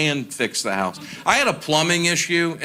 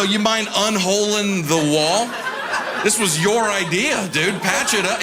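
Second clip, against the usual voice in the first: higher exasperated complaint, laughing; 6 semitones higher than usual. Retold or imitated. Homeowner complaining to the plumber about the hole. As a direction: whine at him to fix it.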